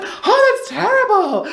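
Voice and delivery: high, slow, hammed-up sympathetic British woman; posh British lady. Mimicking Margaret in the queue, high and slow. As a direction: gush it like a shocked English lady. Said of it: "she was like".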